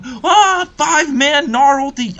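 loud high cry into the call; excited yell. Real, not acted. Commentator reliving five-man Gnar ulti, excited yell. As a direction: burst out yelling at the big ultimate. Yells over slowed replay.

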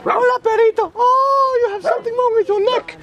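high sing-song voice for a dog; talking to a dog. Real, not acted. Greeting a dog on the path.

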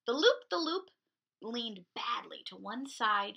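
put-on lively picture-book narration for little kids; storytime reading. A narrator's reading. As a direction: read it like a children's librarian. Picture-book read aloud to kids.

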